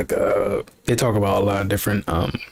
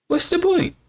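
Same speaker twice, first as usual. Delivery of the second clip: squeaky high. Real, not acted. Rhetorical jab about mocking favourites.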